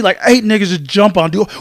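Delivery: normal talking voice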